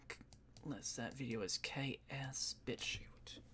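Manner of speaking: hushed aside; whispering. Real, not acted. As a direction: whisper it to yourself. Whispered correction of site name.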